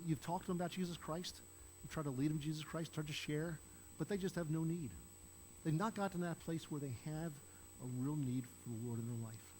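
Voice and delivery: usual speaking voice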